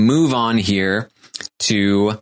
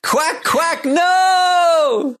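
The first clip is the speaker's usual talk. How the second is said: high, slow, dismayed cheer; Akbar's catchphrase; much slower than usual. Retold or imitated. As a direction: wail it like a crushed announcer. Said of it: "something he would say".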